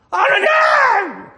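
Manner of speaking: long, high, loud cry to God; shouted cry. Shown as a demonstration. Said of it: "악을 쓰든지". (하나님을 부르짖는 외침)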